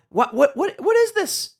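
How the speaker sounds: climbing into a high, distraught put-on voice; hammed-up high-pitched distress; mock panic. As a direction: rise to a squeal of fake disbelief. Mock reading of distraught post.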